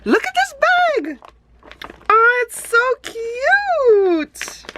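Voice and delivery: very high, gushing over the bag; high-pitched cooing delight; high squeal of delight. Real, not acted. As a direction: squeal like you can't contain it. Unboxing happy mail, squealing delight.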